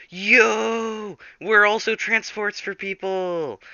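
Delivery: high, drawn-out shared-discovery cheer; mock delighted. B T and Freddy show their passengers, mock delighted. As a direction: exclaim it as a thrilled coincidence. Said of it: "They're like".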